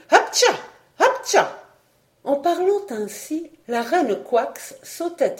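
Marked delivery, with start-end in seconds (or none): 0.1-1.6 two loud, high-pitched sneezes; acted sneezes. A narrator's reading. Frog queen keeps sneezing, high loud acted sneezes. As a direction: sneeze twice, high and explosive. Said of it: "Voilà que j'éternue".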